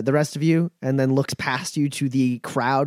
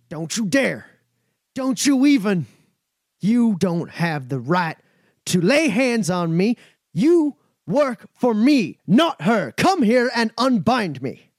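high, loud, raging nobleman; a bound mayor raging at his own staff; furious outburst; 8 semitones higher, 4 dB louder than usual. Acted. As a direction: scream orders at the servants, outraged. Mayor furiously defying servants.

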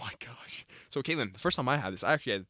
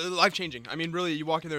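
ordinary talk, nothing marked

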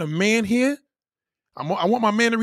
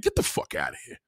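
low, hushed scornful whisper; whispered dismissal; 8 dB quieter than usual. Real, not acted. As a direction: breathe it out with contempt. Dismissing the guest.

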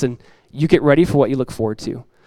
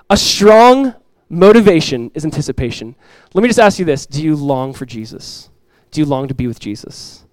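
usual speaking voice

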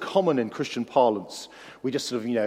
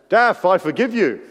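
loud, high call from the back; 6 semitones higher, 9 dB louder than usual. Retold or imitated. A K calling out to David from the back, loud. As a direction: shout it across the room. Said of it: "A K calls out".

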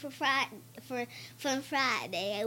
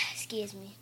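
whispered; 4 semitones lower, 10 dB quieter than usual. Real, not acted. Sassy reply to dad.

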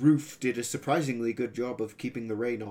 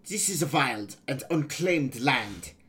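put-on higher-lilted boy pirate with a foreign accent; 3 semitones higher, 4 dB louder than usual. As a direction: voice a young foreign pirate, pitched up.

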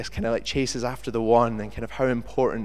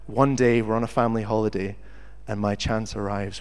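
ordinary talk, nothing marked

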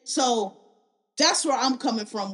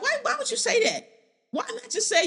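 high exasperated question; incredulous; 11 semitones higher than usual. Real, not acted. Reacting to Jada's comment, a high exasperated question. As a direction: wonder aloud why she'd say that.

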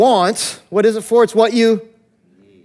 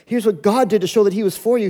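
ordinary delivery

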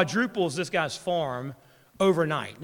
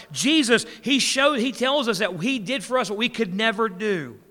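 higher, louder gospel preaching that builds; preaching at full force; 4 semitones higher, 3 dB louder than usual. Acted. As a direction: preach it out with rising fervour to the congregation. Preaching gospel, rising intensity.

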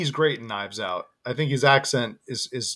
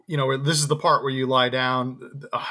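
normal talking voice

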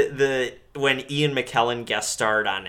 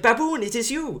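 higher put-on movie voice; silly character voice; 12 semitones higher than usual. Host quoting the movie's phone call, higher put-on voice. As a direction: squeak the line like the cartoonish jungle kid. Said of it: "I hate the voice, Tony".

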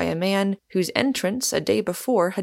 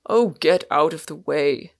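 mildly exasperated but good-natured; lightly gruff, good-humoured dismissal; almost good-humoured; 3 semitones lower, 3 dB louder than usual. A narrator's reading. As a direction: shoo him off with a touch of humour. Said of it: "cried Warner almost good-humoredly".

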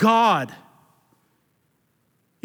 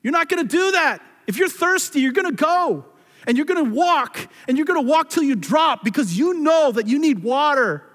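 fiery preaching; 5 semitones higher than usual. Acted. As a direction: push each line harder like a call to action. Preaching builds to full force.

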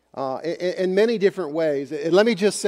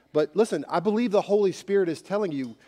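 ordinary delivery

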